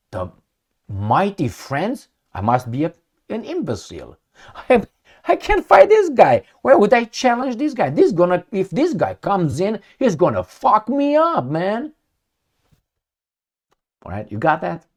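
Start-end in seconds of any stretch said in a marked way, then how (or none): 4.7-11.9 high, loud incredulous protest; pitched-up, exasperated complaint; panicked Russian role. Acted. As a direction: act out a fighter alarmed by his opponent. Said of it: "let's say I'm fighting here".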